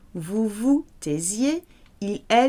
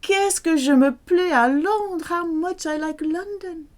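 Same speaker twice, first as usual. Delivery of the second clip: higher gushing exclamation; delighted exclamation; 9 semitones higher than usual. Acted. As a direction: rave about loving London. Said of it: "the French will say something like".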